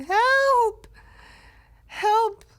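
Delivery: high, loud desperate yell for help; screaming for help. Retold or imitated. Run-over man screams for help after the driver leaves, desperate yell. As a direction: cry out for rescue with all your lungs. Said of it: "screaming bloody murder as loud as I could".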